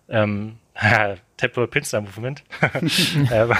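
laughing through the words. Real, not acted. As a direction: chuckle it out. Laughing while naming the term.

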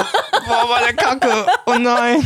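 high-pitched laughing fit; cracking up, can barely talk. Real, not acted. Laughing fit after a fart.

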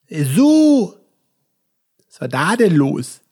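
slow, drawn-out TV host voice; slow late-night TV host voice; Stefan Raab impression. Shown as a demonstration. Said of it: "das war Stefan Raab".

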